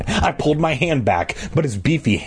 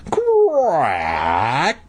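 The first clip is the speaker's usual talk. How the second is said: loud sharp crack. A narrator's reading. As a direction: act out the cracking noise. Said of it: "a loud, sharp".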